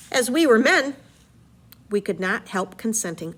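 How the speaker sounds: story narration, read aloud with energy; dramatic reading. Acted. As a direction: read the epic aloud to the class. Reads Odyssey aloud.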